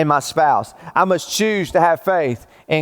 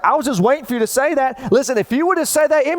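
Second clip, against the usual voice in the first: wife's voice; 9 semitones higher than usual. Retold or imitated. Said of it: "and she's like".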